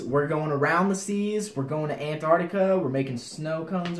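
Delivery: loud, high, drawn-out excited proclamation; hyped up. Acted. [name] hyping viewers about cooking abroad, loud and high. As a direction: announce it like a grand adventure.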